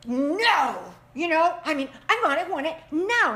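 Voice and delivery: put-on loud, high demanding child; child's tantrum. Parent imitating a demanding child, loud and whiny. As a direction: shout it like a stamping kid.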